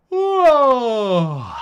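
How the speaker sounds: sudden loud, high whoa of surprise; surprised whoa. Real, not acted. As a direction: blurt it out, caught off guard. Reacting to something on screen.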